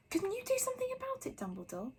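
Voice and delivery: high, fast pleading witch voice; anxious high witch; stern witch. A narrator's reading. McGonagall pleads.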